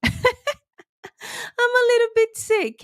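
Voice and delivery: slow, high, giggling through the words; laughing repeat. Real, not acted. Host laughing as she admits to viewers she is sick. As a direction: stretch it out with a laugh.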